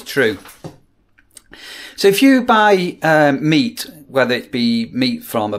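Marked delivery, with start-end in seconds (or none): none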